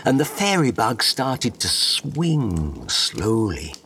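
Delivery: usual speaking voice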